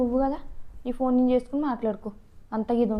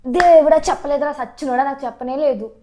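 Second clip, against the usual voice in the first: louder, swearing to God; exasperated oath; 7 dB louder than usual. Acted. Wife swearing to husband she knew nothing, exasperated. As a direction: cry out in protest.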